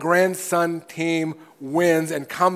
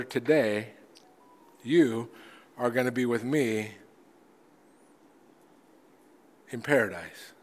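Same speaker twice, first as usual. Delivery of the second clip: tender promise; 4 semitones lower, 7 dB quieter than usual. Acted. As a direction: reassure a dying brother gently, word by word. Jesus promising the criminal paradise.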